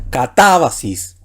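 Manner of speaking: "catábasis" drawn out with the stress on "tá"; ca-TÁ-ba-sis, leaning on the "tá" of "catábasis"; stress on antepenultimate syllable. Shown as a demonstration. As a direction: say "catábasis" slowly, punching "TÁ", then falling away. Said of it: "puede ir en la antepenúltima".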